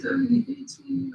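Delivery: high, soft imitated whine; lightly acted drunk girl, high and soft; drunk self-pity. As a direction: imitate a tipsy girl feeling rejected. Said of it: "like, inebriated".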